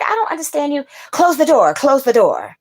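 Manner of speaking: high, loud retold shouting; yelling at the man to close the door. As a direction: bark the order back at him. Retelling her reply at door.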